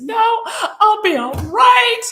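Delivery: high, loud, drawn-out wail; re-enacted tearful protest; hysterical crying. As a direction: wail it slowly, insisting through mock sobs. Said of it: "I'm still crying hysterically".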